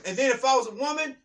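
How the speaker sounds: ordinary talk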